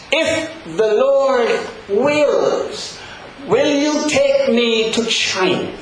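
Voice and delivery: a hint of a small child asking, slow; gently voicing a six-year-old; granddaughter quoted. Grandfather quoting his granddaughter to the congregation, lightly childlike. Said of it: "my granddaughter said to me".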